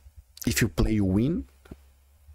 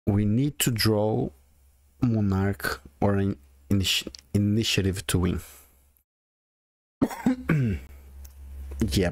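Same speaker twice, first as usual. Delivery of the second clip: ordinary delivery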